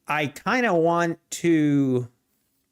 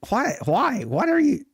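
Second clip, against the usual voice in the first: incredulous why; 8 semitones higher than usual. Real, not acted. Chat mocks his pronunciation.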